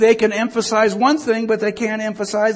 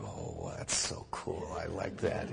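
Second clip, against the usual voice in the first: quiet pleased murmur; 21 dB quieter than usual. Retold or imitated. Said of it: "I went".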